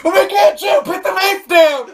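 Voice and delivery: very high, loud panicked yell, laughing; mock recap scream. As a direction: shriek it like a soap cliffhanger. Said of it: "Last time on the Joe Alex podcast".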